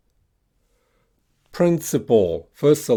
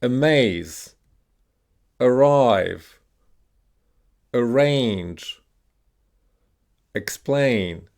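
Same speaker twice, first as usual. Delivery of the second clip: Amaze, arrive, arrange, explain with a long, falling second syllable; stress on second syllable; much slower than usual. Shown as a demonstration. Teacher giving words to repeat, second syllables punched. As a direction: punch MAZE, RIVE, RANGE, PLAIN, each word alone. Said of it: "stressed on the second syllable".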